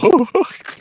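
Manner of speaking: short high-pitched laugh; laughing at his own falsetto. Real, not acted. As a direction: giggle after the joke.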